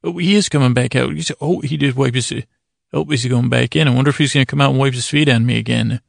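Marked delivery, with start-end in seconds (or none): none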